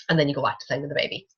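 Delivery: usual speaking voice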